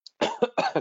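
sudden harsh coughing fit; choking cough. Real, not acted. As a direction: cough hard, choking on water. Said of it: "I'm choking now on my water".

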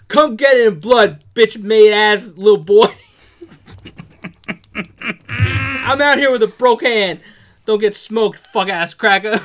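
loud, high, aggressive street threat; angry read. Shown as a demonstration. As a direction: read it like you mean the violence. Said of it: "say this with some fucking aggression".

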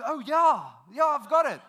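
excited reply. Retold or imitated. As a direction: voice her thrilled to help. Excited voiced cafeteria lady.

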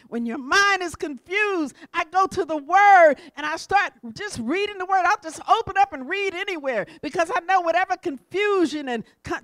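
loud, driving sermon delivery; forceful preaching. Acted. Preacher listing what the word does for the mind, preaching forcefully. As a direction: punch out each promise to the congregation.

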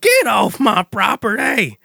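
slow hollered get off my property; Fuzzy Lumpkin yell. Retold or imitated. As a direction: holler it like an angry old hermit. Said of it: "There's these people on his lawn".

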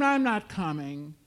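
loud, emphatic refusal short of a scream; screamed refusal, as narrated. A narrator's reading. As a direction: push each refusal out forcefully. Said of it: "She screamed".

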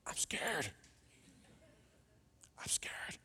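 tiny, hushed voice sinking to a whisper; imitated scared child. Evie answering when offered the frog, scared whisper. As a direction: whisper it as a timid little kid. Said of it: "And what does Evie say?".

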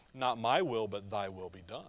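quiet, tender submission to the father; hushed surrender. Retold or imitated. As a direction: let it fall away to a near hush. Jesus' prayer quoted, second time.

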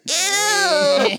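high squealing get it away; phobic panic. Pretend. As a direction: shriek like a strawberry touched by another. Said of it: "it's like".

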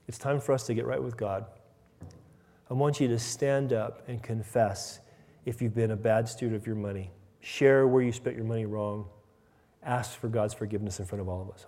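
low, soft, measured appeal; hushed, grave invitation to the congregation; solemn altar call. Pretend. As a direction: quietly invite them to stand and confess. Imagined altar call to confess.

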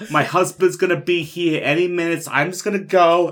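put-on indignant old woman. Old woman's excuses voiced.

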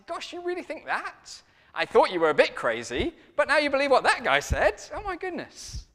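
very high, mock-astonished voice; hammed-up shock, mostly high and punchy; scandalised disbelief. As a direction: imitate a guest appalled at what they heard. Acted guest's shocked reaction to event.

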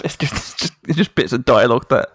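normal talking voice

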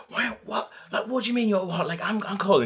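high-pitched, incredulous mock protest; imitated indignant comeback. Retold indignant comeback in quarrel.

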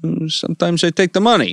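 high tough-guy mafia voice; a lightly played mafia fairy; mobster voice. Mafia tooth fairy line.

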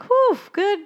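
high-pitched mock sigh of relief; relieved. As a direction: imitate someone who just had a close shave. Fish relieved after narrow escape.

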